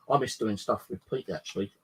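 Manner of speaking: usual speaking voice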